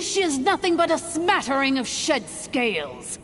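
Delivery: ordinary delivery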